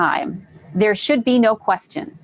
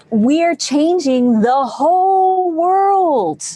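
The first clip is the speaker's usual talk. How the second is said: high, loud, slow proclamation; rousing; 7 semitones higher, 4 dB louder, much slower than usual. Real, not acted. Coach inspiring challenge members, high and loud rousing call. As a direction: stretch it out like a rally cry. Rallying the group.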